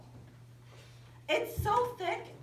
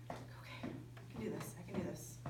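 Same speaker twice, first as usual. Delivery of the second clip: softly talking herself into it; quiet self-encouragement; 6 semitones lower, 11 dB quieter, faster than usual. Retold or imitated. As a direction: murmur it to steady yourself. Self-talk, scared on cliff.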